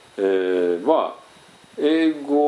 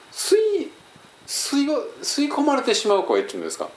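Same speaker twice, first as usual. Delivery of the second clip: much higher voice that seems sucked away; swallowed, absorbed voice; 13 semitones higher than usual. Shown as a demonstration. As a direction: demonstrate a high voice with no carry. (吸い込まれてしまうような高い声)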